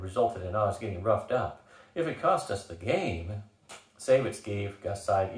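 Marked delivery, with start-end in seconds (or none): none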